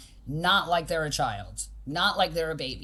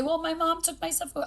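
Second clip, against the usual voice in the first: put-on much higher whiny kid excuse; imitating a kid; 10 semitones higher than usual. Coach imitating a child blaming his mom, higher whine. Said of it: "And then he could say".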